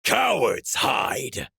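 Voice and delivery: loud, slow snarl. A narrator's reading. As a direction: snarl it with contempt. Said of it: "Darvok snarled".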